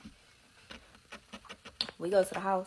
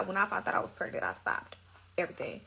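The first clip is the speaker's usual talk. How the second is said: normal talking voice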